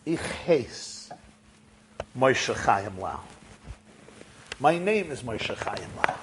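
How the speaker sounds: low, hushed, halting confession; subdued, mildly tearful reply. A narrator's reading. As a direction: say it softly through held-back tears, pausing. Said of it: "Rabbi Lau starts crying".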